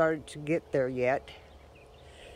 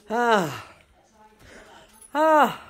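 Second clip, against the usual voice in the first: winded gasps; 4 semitones higher, 7 dB louder than usual. Real, not acted. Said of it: "Now I'm out of breath".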